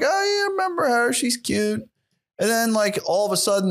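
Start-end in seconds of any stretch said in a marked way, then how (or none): 0.0-1.9 higher, fast, put-on voice; mock self-reply. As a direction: squeak it out as an airy mock reply. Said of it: "And I'm like".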